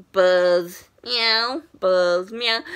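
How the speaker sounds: mock buzz then a light meow, alternating; bee buzz and cat meow. Actors answering a fan's request, buzzing and meowing. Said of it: "Mark and Misha just went".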